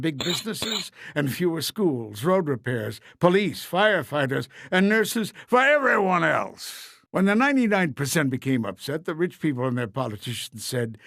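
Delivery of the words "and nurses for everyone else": ordinary delivery, nothing marked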